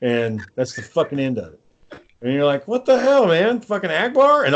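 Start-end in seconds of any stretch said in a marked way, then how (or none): 2.7-4.4 high-pitched outraged protest; indignant disbelief. Retold or imitated. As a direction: protest as a stunned fan, pitched up. Voices outraged viewer's reaction to death.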